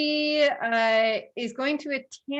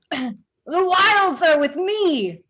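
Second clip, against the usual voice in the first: high, loud, drawn-out battle cry; druid's yell; 6 semitones higher, 7 dB louder, slower than usual. Acted. Game master voicing an attacking druid, yelling. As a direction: yell it out slowly, pitched high. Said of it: "she yells".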